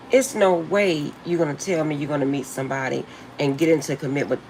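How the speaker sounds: normal talking voice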